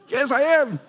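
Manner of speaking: high, loud, breezy yes; cheerful agreement. Shown as a demonstration. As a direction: cheerfully own the accusation. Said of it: "Always agree to accusation".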